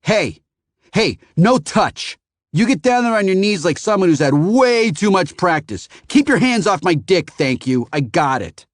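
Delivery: disgusted rebuke. A narrator's reading. Phoenician fends off the kneeling kid, disgusted. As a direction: push him off in disgust.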